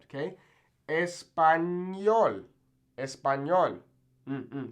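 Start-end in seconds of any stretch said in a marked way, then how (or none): 0.9-3.8 "Español" slow as es-pan-YOL; N Y sound. Shown as a demonstration. Teacher models the ñ, N Y sound. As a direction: stretch the ny in "Español". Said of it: "makes this kind of N Y sound".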